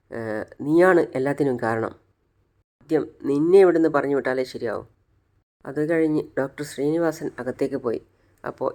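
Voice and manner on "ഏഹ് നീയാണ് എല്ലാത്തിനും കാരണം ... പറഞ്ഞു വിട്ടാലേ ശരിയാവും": usual speaking voice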